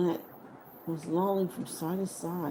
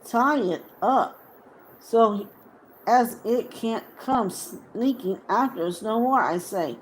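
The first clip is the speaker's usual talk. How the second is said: Sam; 4 semitones higher, 7 dB louder than usual. Acted. Sam demands Gollum be tied.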